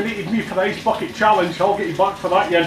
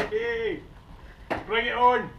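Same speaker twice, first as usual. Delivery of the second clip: defiant bring it on; 5 semitones higher, 10 dB quieter, much slower than usual. Real, not acted. Bracing for the ice bucket.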